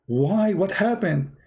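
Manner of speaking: high, bewildered son's voice; pleading son. Retold or imitated. Son protests to his father.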